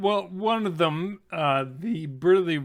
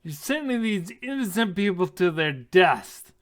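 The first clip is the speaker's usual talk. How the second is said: heated, raised voice; angry accusation; 5 semitones higher, 6 dB louder than usual. Acted. Half-elf accusing a dwarf of risking innocent lives, angrily. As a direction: berate them, pitch climbing. Newcomer keeps berating the dwarf.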